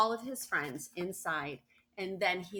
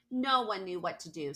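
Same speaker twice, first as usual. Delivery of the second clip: usual speaking voice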